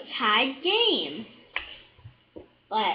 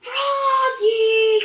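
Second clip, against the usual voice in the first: high, loud call across the field; coach calling out; 9 semitones higher, 6 dB louder than usual. A narrator's reading. Coach yelling to Froggy across the field, loud call. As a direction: call his name sharply across the pitch. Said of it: "called the coach".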